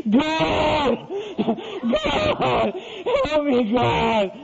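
high, sobbing cry for rescue; hammed-up child howling to heaven; wailing prayer. Son facing a spanking cries to God, mock wailing.

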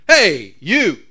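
loud parrot hollering across a room; big two-beat shout across the store; calling out. Acted. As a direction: holler it to get someone's attention. Parrot calls across the pet store.

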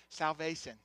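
low, quiet imitation of son's "Salvation"; imitating young son. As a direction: say "Salvation" as the little boy does. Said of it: "That's how he talks".

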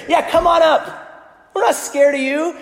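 high, loud mocking bravado; arrogant taunt. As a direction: dare them up with cocky scorn. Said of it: "you hear the arrogance of the Philistines here".

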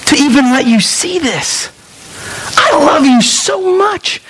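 high, earnest declaration of love; heartfelt, emphatic divine affection; God's loving voice. Acted. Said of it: "He, he says".

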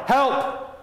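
high, frightened calls of help; a lost girl calling out in fear; crying for help. A narrator's reading. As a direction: cry out high for help, scared. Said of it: "She began to cry".

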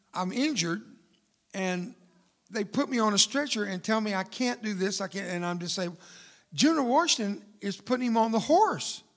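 ordinary talk, nothing marked

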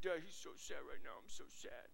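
mopey dog voice, fading to a whisper; Frank voice. Retold or imitated. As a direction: voice the sad basset hound, sinking low. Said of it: "plays a great Frank voice".

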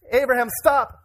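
high, loud call to stop; urgent halting shout. Acted. Voice from heaven halting Abraham, urgent shout. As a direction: cut in urgently, pitched well up.

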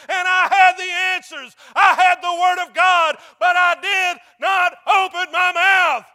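loud, high preacher's cry of remorse; impassioned confessional prayer. Acted. Preacher voicing a sorry believer's prayer to God, loud and impassioned. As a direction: cry out the apology at full pulpit force.

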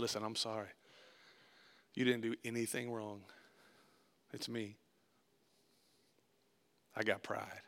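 low, hushed confession; humble apology. Retold or imitated. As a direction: own the fault softly and gently. Humble apology to Chris, retold.

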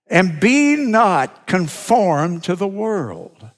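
slow, solemn verse reading; slow scripture reading. Real, not acted. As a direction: read each word slowly. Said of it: "Look at verse two".

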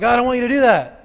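loud, high exasperated objection; sudden parental outburst. Acted. Lax parent suddenly objecting to the kids, loud outburst. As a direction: erupt like a parent who has had enough. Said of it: "but then we're like".

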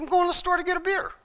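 high, fast, innocent-sounding answer; mock innocent reply. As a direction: play dumb with the cop, high and quick.

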